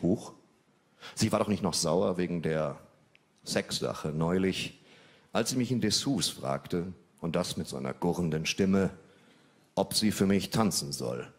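ordinary talk

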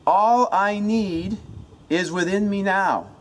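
high, loud, drawn-out chant; shouted incantation. Shown as a demonstration. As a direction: belt it out slowly like a rallying mantra. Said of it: "you say it out loud".

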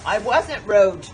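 loud. Real, not acted. Heated argument with shop worker.

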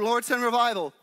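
ordinary talk, nothing marked

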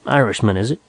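ordinary delivery

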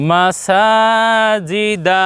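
মাসাজিদা run together with a long-held সা; slow, sustained মাসাজিদা, drawing out সা; chant-like level tone on মাসাজিদা, সা longest; syllables joined, stretched. Shown as a demonstration. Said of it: "টান বেশি দিচ্ছি".

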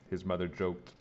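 plain reading voice

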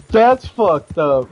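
loud, slow, stretched-out outrage; appalled and loud, spelling out every word; loud disbelief. Real, not acted. Reacting to a hog-tied victim.

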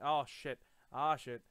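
loud, sudden alarmed swearing; startled curse. Real, not acted. Gamer caught off guard by a mob, loud startled curse. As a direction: blurt it out in a real fright.

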